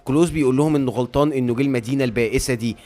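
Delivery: ordinary delivery, nothing marked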